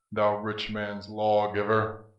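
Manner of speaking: usual speaking voice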